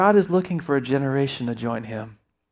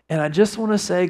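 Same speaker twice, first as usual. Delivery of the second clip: usual speaking voice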